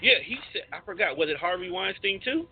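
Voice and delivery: usual speaking voice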